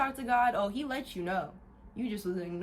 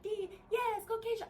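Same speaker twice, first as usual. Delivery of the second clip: high-pitched imagined cheerleader; playful high cheer-squad call; 12 semitones higher than usual. Pretend. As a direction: cheer someone on in a much higher voice. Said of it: "if I had ten cheerleaders".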